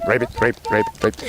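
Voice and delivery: soft repeated ribbit croaks; toad croaking. Acted. Tired toad leaves the garden, soft retreating croaks. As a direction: imitate a toad hopping away.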